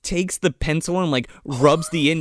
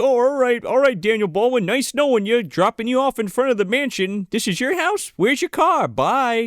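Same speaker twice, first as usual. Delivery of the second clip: high-pitched mock cheery send-off; hammed-up high goodbye patter; hurried farewell; 9 semitones higher than usual. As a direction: imitate someone briskly getting rid of a passenger. Retold brisk farewell at mansion.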